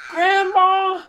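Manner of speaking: loud, high happy call; high singsong shout. Acted. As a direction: call a name across the room. Calling out to grandmother.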